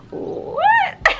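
very high, loud squeal of disbelief; amused shock. Real, not acted. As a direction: squeal it, breaking into laughter. Shocked at own old writing.

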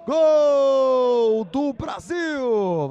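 long drawn-out goal roar; goal shout. Real, not acted. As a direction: hold the goal call loud and high. Goal called for Brazil.

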